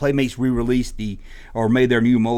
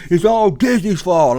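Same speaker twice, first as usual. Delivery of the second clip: slow, loud, higher whiny fan voice; mocking fan; 6 semitones higher, 5 dB louder, slower than usual. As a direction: drag it out like a sulky fan. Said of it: "his fan base were all like".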